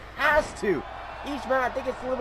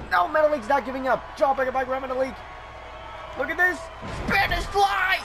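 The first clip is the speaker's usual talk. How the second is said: live play-by-play; 7 semitones higher than usual. Real, not acted. Commentator calling the near falls for viewers, shouting excitedly. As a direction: call each move as it lands, building to the kickout. Calls a wrestling match live.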